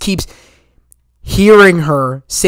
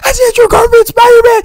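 screaming the reveal to the audience; excited shout. Retold or imitated. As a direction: yell it to the whole theater. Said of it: "I was like".

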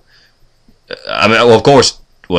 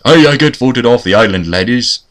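put-on higher, Billy Connolly-style Scottish accent; 3 semitones higher than usual. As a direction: put on a hammy Scot, pitched up. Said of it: "island with a Scottish accent".

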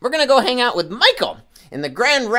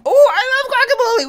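puppet voice; 6 dB louder than usual. Pretend. Puppet Peanut exclaims.

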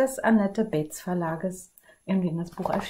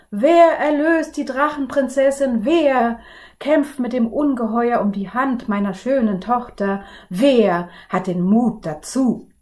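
higher, louder proclamation; king's call; 4 semitones higher, 7 dB louder than usual. Acted. King calling to the princes from the balcony, loud. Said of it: "rief der König mit bebender Stimme".

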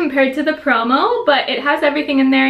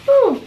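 high whoop; 12 semitones higher, 4 dB louder than usual. Real, not acted. Reacting to tasting the chicken.

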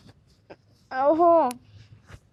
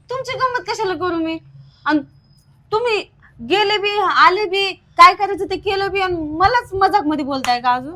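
higher, louder accusing outburst; angry accusation; 6 semitones higher, 7 dB louder than usual. Acted. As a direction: throw the blame at him. Wife accusing husband in quarrel.

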